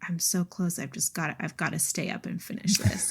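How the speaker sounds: soft, whispered self-talk so nobody wakes; whispering to yourself past midnight; hushed late-night whisper. Retold or imitated. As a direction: murmur it under your breath at night. Late night, partner asleep.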